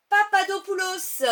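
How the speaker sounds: nom de marque lancé fort et haut; annonce forte. Real, not acted. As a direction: proclamer la marque avec éclat. (annonce enthousiaste)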